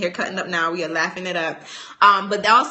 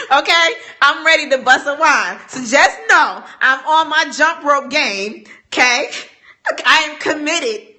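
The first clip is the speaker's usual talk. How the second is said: loud, higher, playful boasting; louder, higher mock-serious boasting; playful hype; 6 semitones higher, 6 dB louder than usual. Real, not acted. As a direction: keep the energy high. Keeps hyping, laughing.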